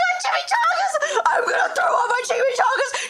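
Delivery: high, shrieking nausea; hammed-up squealing distress; wailing panicked friend. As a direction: shriek like a friend about to be sick. Friend screaming about feeling sick.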